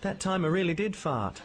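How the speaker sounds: high, boyish cartoon train voice; chirpy little train apologising; Stevie the steam train.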